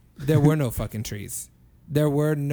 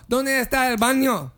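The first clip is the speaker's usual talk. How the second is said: higher, confident Spanish question; phrase remembered; 7 semitones higher than usual. Retold or imitated. Customer finally finding the Spanish phrase, higher and sure. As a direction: come out with the whole question, pitched up. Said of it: "yeah, exactly. I go".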